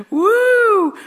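a sudden high, playful woo; playful whoop. Pretend. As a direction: let out a joking cheer. Playful exclamation after laugh.

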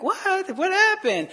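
high-pitched acted disbelief; mock bewilderment. Acting out the listener's shock at sudden danger, mock bewilderment. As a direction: blurt it as a baffled onlooker. Retold reaction to sudden threat.

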